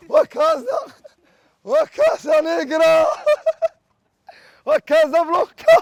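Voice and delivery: very loud, high drawn-out call; rallying shout. Pretend. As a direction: holler to the whole city, stretching the names. Hails Casablanca from the table. (cri de ralliement, fort et aigu)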